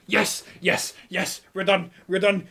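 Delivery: loud, high shouts of real victory; triumphant yelling. Real, not acted. Streamer celebrating finishing the long event, loud triumphant cheering. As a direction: cheer it out at the top of your lungs.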